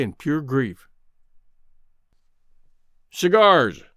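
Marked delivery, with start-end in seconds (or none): 3.1-3.8 curt, louder call of cigars; harsh call. Acted. Greenbrier calling the waiter, harsh loud order. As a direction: bark the order to hide the grief. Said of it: "he called harshly to the waiter".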